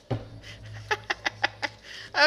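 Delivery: high cackle. Retold or imitated. Laugh in put-on voice.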